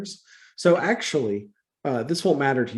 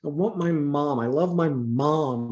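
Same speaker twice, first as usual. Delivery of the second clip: usual speaking voice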